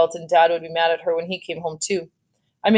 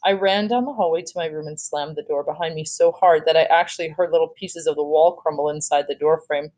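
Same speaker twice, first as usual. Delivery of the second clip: usual speaking voice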